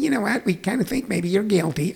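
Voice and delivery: tentative little voice for the verdict; cartoon-voiced judge. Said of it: "should be voicing Warner Brothers cartoons".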